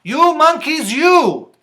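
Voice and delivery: high, loud storybook scolding; scolding peddler. Acted. Peddler scolds monkeys who took his caps, high and loud. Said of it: "shaking a finger at them".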